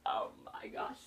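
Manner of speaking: breathless whispered gasp of shock; hushed oh my gosh; stunned whisper. Retold or imitated. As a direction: whisper it as if frozen in the cinema seat. Stunned reaction to Martha reveal.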